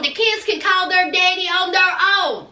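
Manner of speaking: ordinary talk, nothing marked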